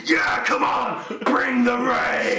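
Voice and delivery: high, full-on hyped shout; too pumped up. Acted. Pumped-up extra daring the film crew to soak him, wild yell. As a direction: roar it out, stretching the last words. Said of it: "would you be too pumped up".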